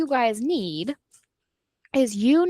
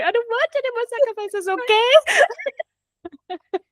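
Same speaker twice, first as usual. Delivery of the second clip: much higher, pleading little voice; mock Evie voice; 11 semitones higher, 3 dB louder than usual. Friend acts out Evie refusing the sacrifice, high-pitched pleading. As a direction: squeak it out like she's begging off.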